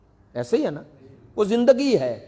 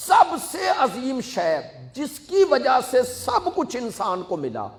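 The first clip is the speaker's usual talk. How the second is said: preaching shout; 6 semitones higher than usual. Real, not acted. Preacher builds to climax.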